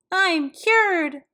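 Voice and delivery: high, loud triumphant cry; a cured girl shouting happily; joyful shout. A narrator's reading. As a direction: shout for joy. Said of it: "she shouted".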